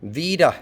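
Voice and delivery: Vida stressed on VI, weak schwa ending; stress demo. Shown as a demonstration. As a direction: lean on the first syllable of Vida. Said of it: "the stress is, uh, here".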